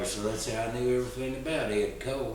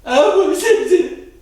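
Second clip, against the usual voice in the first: mock crying boy; 13 dB louder, slower than usual.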